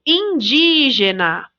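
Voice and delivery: "indígena" drawn out slowly on the DI; slow "indígena" with a long stressed DI; stressed syllable. Shown as a demonstration. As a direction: stretch the DI in "indígena". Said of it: "a sílaba tônica é o di".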